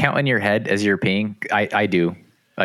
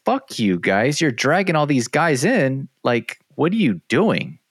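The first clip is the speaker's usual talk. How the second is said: angry retort; 8 semitones higher, slower than usual. Retold or imitated. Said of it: "I was so mad".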